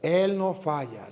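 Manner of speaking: ordinary delivery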